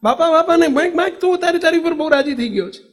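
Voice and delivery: pleased Bapa quoted. Acted. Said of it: "એમણે માગ્યું".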